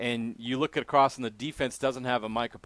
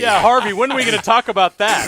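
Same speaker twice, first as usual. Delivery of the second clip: laughing; 10 semitones higher, 13 dB louder than usual. Real, not acted. Teasing laughter.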